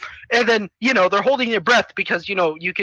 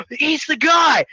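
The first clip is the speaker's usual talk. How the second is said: high, amazed mock gasping voice; mock awe; 6 semitones higher, slower than usual. Onlooker seeing the armored newcomer, mock awe. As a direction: gasp it high, in pretend wonder.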